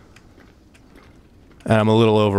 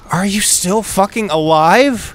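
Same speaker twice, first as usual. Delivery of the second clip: incredulous; 10 semitones higher, 4 dB louder than usual. Real, not acted. Disbelief at surviving enemy.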